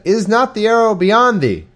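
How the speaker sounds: called out high and loud across the field; crying out. A narrator's reading. Jonathan calls after his lad across the field, loud and high. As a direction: shout it after the running boy. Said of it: "Jonathan cried after the lad and said".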